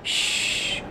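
श as a long unvoiced hiss, tongue curled; sh sound demo. Shown as a demonstration. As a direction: breathe out a soft श with the tongue rolled. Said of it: "पहले आप air gather कीजिए inside". (जीभ मोड़कर लंबा श)

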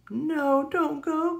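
high, pleading cry. A narrator's reading. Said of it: "cries Little Bear".

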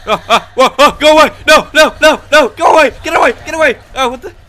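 high, loud panicked screams; terrified shouting. Real, not acted. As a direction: yell it while being chased. Chased by monster.

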